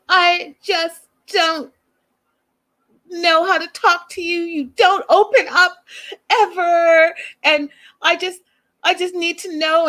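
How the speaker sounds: high, drawn-out imitation of tearful pleading; mock crying. Host mimicking a girlfriend confessing to her boyfriend, mock crying. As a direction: imitate a weepy, whiny confession. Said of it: "she starts crying again".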